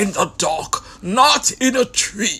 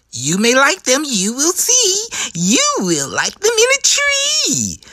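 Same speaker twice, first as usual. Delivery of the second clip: higher, sing-song promise; coaxing Sam; 7 semitones higher than usual. Acted. Sam promising he'll like them, high and sing-song. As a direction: tempt him like it's a treat.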